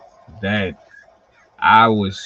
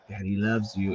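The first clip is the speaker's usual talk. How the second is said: put-on tender baby talk; 4 semitones lower, 7 dB quieter than usual. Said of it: "I be patting her like".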